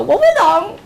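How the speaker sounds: high, loud pupil's yell; loud, high-pitched mock classroom shout; imitated loud shout. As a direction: mimic a pupil yelling as loud as possible. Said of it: "as loud as they can".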